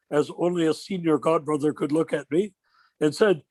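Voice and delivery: usual speaking voice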